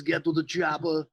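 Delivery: a light stab at an Austrian action-hero growl; Arnold impression. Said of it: "everybody does Arnold".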